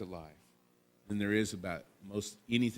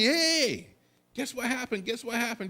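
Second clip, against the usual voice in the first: excited shouting; 14 semitones higher, 7 dB louder than usual. Pretend. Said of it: "running with excitement and shouting".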